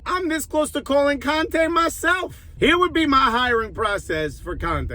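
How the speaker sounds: ordinary delivery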